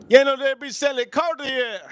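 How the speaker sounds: high-pitched put-on Spanish grandmother; high voice quoting grandmother; imitating grandmother. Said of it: "My grandmother likes to say".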